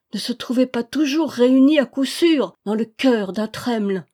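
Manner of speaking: exalted, emphatic tribute; fervent avowal. A narrator's reading. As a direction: declare devotion with fervour. Fervent speech to recognised master.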